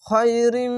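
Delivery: খয়রিম recited with ghunna; nasal merging recitation. Shown as a demonstration. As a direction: chant খয়রিম with nasalized merge. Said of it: "ঈদগামে বা গুণনা".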